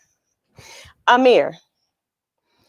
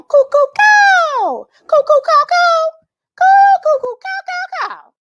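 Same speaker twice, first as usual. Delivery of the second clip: much higher, louder, slow bird cry; blue jay call; 8 dB louder, slower than usual. Retold or imitated. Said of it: "what that Blue Jay might be saying".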